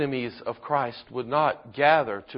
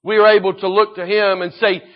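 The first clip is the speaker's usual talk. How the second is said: raised, higher, louder pulpit voice; fervent sermon at full force, loud and high; impassioned preaching; 9 semitones higher, 10 dB louder than usual. Acted. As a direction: drive the message home to the congregation.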